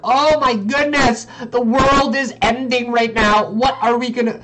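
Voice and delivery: mock panic. Streamer imitating panicking traders, mock panic. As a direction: act out traders panicking, hammed-up and loud. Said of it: "people were actually panicking right here".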